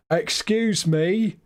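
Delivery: very slow, drawn-out "Excuse me"; a prim Brit asking a stranger; mock polite British tourist. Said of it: "be all British and go".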